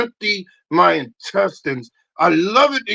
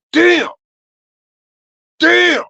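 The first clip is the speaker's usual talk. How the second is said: much higher, louder damn; exclamation; 10 semitones higher, 9 dB louder than usual. Acted. Reacting to the gym toilet stench, loud damn. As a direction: blurt it out in shock.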